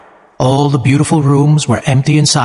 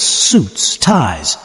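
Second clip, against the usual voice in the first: usual speaking voice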